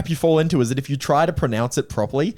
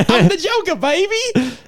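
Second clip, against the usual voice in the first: very high, hammed-up villain voice; Joker. Goofing as Harley's partner, high-pitched mock villain. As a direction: squeal it out as a mock comic-book villain. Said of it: "a conversation with the Joker".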